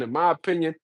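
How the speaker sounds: ordinary talk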